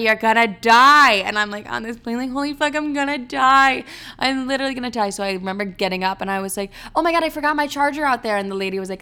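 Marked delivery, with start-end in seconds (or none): 0.0-1.1 loud, slow pronouncement of doom; alarmed warning. Retold or imitated. Her own thought scolding her on the plane, alarmed warning. 2.3-5.1 high, loud alarmed outburst; dramatized panic. Retold or imitated. Passenger panicking on the plane, dramatized panic. 7.0-8.4 high, rushed excuse; feigned alarm. Retold or imitated. Passenger inventing a reason to get off, feigned alarm. Acts out pretext to flight attendant.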